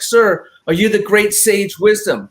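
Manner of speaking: a breathless climber asking; hiker's question. Retold or imitated. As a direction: hiker arriving at the summit. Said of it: "He's like".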